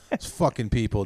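usual speaking voice

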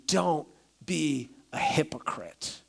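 slow breathy whisper, no voice. Shown as a demonstration. Preacher whispering the line to the congregation. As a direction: hush it like a secret warning.

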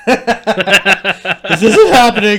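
loud, high hammed-up wailing; high-pitched comic shouting; mock panicked denial. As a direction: yell it like a badly faked victim in denial. Acting someone denying what is happening.

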